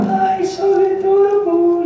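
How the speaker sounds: put-on very high, loud girlish squeal; mock adoring coo, way up high; gushing girls. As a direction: imitate swooning girls in falsetto. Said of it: "all the girls would run after me and go".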